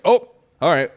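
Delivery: jolted reaction, acted out; mock surprise. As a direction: retell being caught off guard. Reacts to her sudden anger.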